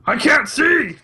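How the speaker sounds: high-pitched hammed-up yell; mock anguished shout. Podcaster playing a man just made blind, mock anguished shout. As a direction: cry it out loudly, way up high.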